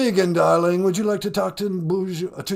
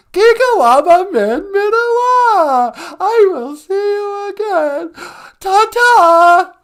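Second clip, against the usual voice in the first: much higher, louder, gushing caricature of Megan; mock Megan voice; 10 dB louder, slower than usual. Host plays Megan on the phone, high gushing squeal. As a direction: squeal it like an overexcited fan.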